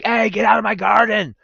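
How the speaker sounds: playful crotchety grandpa yelling; mock grumpy grandpa yell. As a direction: chase kids off the garden, hammed-up. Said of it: "I'm gonna be the grandpa that's like".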